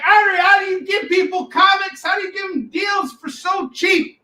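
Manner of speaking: high shouted mock rage at the bargain. Host imitating Kevin raging at cheap deals, high mock shout. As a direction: ham up an angry partner yelling. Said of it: "be like".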